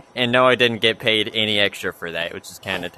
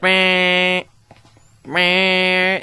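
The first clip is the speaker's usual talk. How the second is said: high, loud nasal-free mock buzzer "meh, meh"; two sharp beeps like the speaker box going off; imitated drive-thru beep; 8 semitones higher, 4 dB louder than usual. Vlogger mimicking the drive-thru alert beeping at her. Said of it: "it's like".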